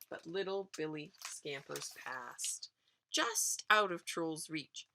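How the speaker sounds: plain reading voice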